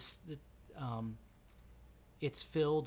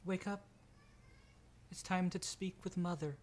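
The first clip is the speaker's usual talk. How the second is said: higher pitched Sarai summoning; higher voice, calm summons to Mother; 7 semitones higher than usual. Acted. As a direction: raise the voice up for Sarai waking them. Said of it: "She goes".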